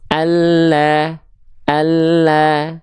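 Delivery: long thin "Allah" as in recitation. Shown as a demonstration. Teacher models a thin drawn-out "Allah".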